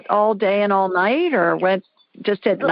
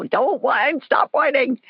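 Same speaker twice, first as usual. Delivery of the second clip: mock parental telling-off, pitched up; higher-pitched, mildly exasperated parent; lightly voiced scolding parent; 6 semitones higher, slower than usual. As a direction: lightly act a parent fed up with whining. Voicing a parent answering whining toddler.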